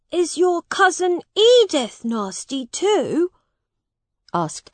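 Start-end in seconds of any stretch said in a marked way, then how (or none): 0.1-3.3 put-on high young prince questioning; a boy asking eagerly; curious boy king. A narrator's reading. Edward asks Miles about Edith, high and curious.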